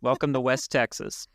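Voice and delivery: a hint of a put-on West Texas twang; lightly voiced West Texas greeting; bad West Texas accent. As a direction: ham up a cowboy welcome, lightly. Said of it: "That was not a good West Texas accent".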